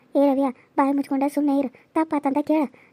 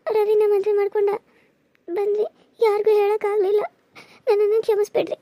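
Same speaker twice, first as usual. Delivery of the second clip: higher, crying through the words; tearful plea; 6 semitones higher than usual. Acted. New bride begging her husband's uncle for forgiveness, tearful. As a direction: beg forgiveness through tears.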